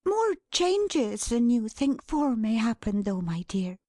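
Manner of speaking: gently hint at news in a lifted voice; Dot, kindly and hinting. Acted.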